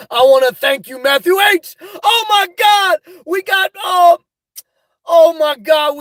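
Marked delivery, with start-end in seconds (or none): 2.0-6.0 high, loud oh my God; thrilled to see the donation; delighted shout at the chat; excited. Real, not acted. Spots a super chat.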